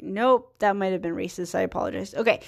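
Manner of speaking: ordinary delivery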